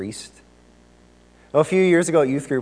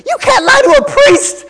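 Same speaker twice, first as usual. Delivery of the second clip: very high, very loud imitated shout; super loud girl; 12 dB louder than usual. Girl at youth group calls out the pastor, super loud. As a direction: blurt it out as a high-pitched yell. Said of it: "super loud, she just goes".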